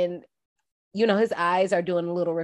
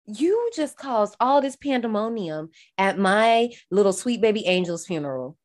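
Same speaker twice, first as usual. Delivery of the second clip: ordinary delivery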